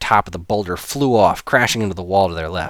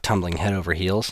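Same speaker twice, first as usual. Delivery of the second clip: ordinary delivery, nothing marked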